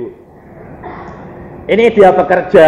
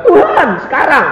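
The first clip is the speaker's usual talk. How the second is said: seruan lantang bernada tinggi kepada Tuhan; menuntut keras; 11 semitones higher, 4 dB louder than usual. Retold or imitated. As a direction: call out insistently, high and raised. Acted demand to God, retold. (menuntut dengan suara tinggi)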